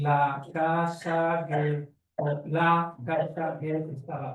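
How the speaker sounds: slow, halting word-by-word reading; halting decoding. Shown as a demonstration. Child decoding a story aloud, slow and halting. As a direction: stumble through it like a child sounding out words. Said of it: "le enseña a decodificar".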